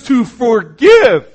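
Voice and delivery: to forgive, slow and loud; drawn-out emphasis. Acted. Preacher warning the congregation not to refuse, slow loud emphasis. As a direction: stretch and shout forgive home.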